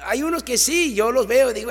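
ordinary delivery

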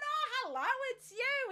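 cheery greeting. Retold or imitated. Patient greeting the pathologist again, bright and high. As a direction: greet him brightly like an old friend. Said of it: "I've gone".

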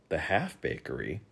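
soft, puzzled query; questioning. A narrator's reading. Boy softly questioning the king's odd word. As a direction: ask it softly, unsure of the term. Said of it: "questioned Milo".

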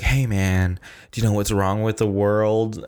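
a hint of an odd stranger cornering him; intense stranger. Stranger accosts him at FedEx.

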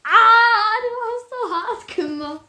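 high, loud yelp of pain; pained cry. Real, not acted. Punched hard on the arm, she yelps in pain.

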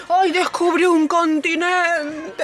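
high, drawn-out exclamation; hammed-up wonder, slow and high; mock amazed discovery. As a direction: play an explorer marvelling at his accidental find. Mocking Columbus's surprised discovery.

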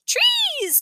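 put-on very high, tiny-child squeal; toddler's voice. A narrator's reading. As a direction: pipe it up like a toddler. Toddler Tootsie echoes Grandma's word.

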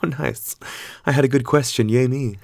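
self-mocking pride. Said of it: "And I was like".

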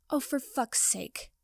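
slow, exasperated grumble; grumbling. Acted. Potter finally releasing Draco's hair, slow grumble. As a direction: give up with an annoyed grumble. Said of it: "Potter grumbled".

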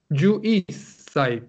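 niyu isai said slowly, syllable by syllable; slow demo niyu isai. Shown as a demonstration. Teacher modelling twenty-one for the class, slow. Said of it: "Once, por ejemplo".

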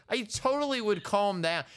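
normal talking voice

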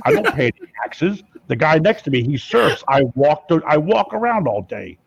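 hammed-up Jesse the Body Ventura growl; phone prank as the former governor, boastful; Jesse Ventura impression. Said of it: "I'm like".

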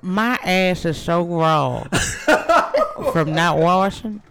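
slow, loud, angelic voice with a laugh; heavenly voice. Acted. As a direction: keep the heavenly voice going, laughing. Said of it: "heavenly voice".

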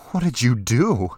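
shocked disbelief, pushed up loud; horrified. A narrator's reading. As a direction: ask it aghast, voice jumping up.

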